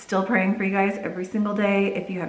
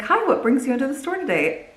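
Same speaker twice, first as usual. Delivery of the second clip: bright, higher chirpy greeting of a store clerk; friendly shop lady; 7 semitones higher, 3 dB louder than usual. Retold or imitated. Store lady greeting customers, imitated higher and chirpy. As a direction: imitate the cheery sales lady's welcome. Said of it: "is very friendly and she's like".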